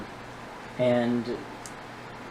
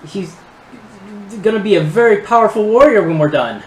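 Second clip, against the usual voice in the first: higher voice, halting explanation; nervous gnome inventor; 6 semitones higher, 6 dB louder than usual. Acted. Gnome describing his creation to his captors, nervous and halting. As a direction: stumble through describing your creation, voice raised.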